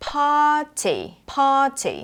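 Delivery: 'party' slowly as 'pah-tee'; no R at all in 'party', long 'ah'; silent R. Shown as a demonstration. Teacher showing the R has no sound, slow 'pah-tee' for 'party'. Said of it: "ตัว R ที่นี่ไม่มีเสียง".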